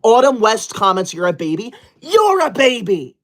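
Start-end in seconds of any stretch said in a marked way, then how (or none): 2.0-3.2 high, slow, indignant retort; upset and angry. Acted. Streamer retorting to baby comment, upset and angry. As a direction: throw the insult straight back, outraged. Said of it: "I'm getting upset and angry".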